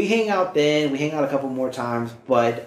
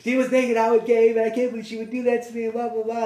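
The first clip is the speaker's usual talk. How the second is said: mock tearful complaint; 7 semitones higher than usual. Upset friend complains about the other girl, mock-tearful and high. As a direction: imitate a crying friend, pitched up. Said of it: "she starts crying".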